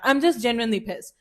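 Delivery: ordinary talk, nothing marked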